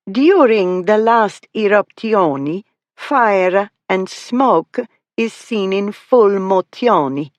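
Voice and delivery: high, slow, hammed-up Italian with rolled R's; extremely Italian accent. A narrator's reading. Miss Flower showing off from the catalogue, extreme Italian accent. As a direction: add extra vowels to word endings, theatrically Italian. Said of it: "rolled the R's and added extra vowels to the ends of the words".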